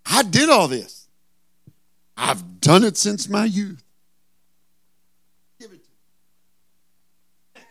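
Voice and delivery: smug and high, grinning. Acted. Rich young ruler boasting to Jesus, grinning. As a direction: boast through a wide grin. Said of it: "he is grinning ear to ear".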